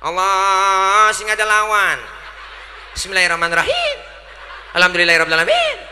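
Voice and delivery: loud, high mock Ambonese prayer leader; imam logat Ambon. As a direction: belt the prayer out in a put-on Ambonese voice. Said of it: "teman kita dari Ambon". (imam logat Ambon, lantang dan tinggi)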